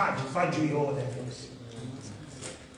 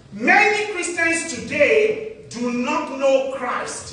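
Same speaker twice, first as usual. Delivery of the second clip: much louder and higher, each word hammered slowly; loud repeat; 8 semitones higher, 8 dB louder, much slower than usual. Real, not acted. Preacher repeats it to the congregation, loud and slow. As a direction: drive the point home, slow and booming. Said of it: "I repeat again".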